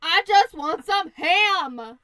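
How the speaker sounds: loud, drawn-out wolf lament; mock frustrated wail. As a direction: wail it slowly at full volume. Wolf's frustrated outburst.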